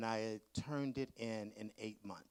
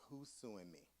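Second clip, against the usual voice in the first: worried mutter; 6 semitones lower, 17 dB quieter than usual. Retold or imitated. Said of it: "and I was like".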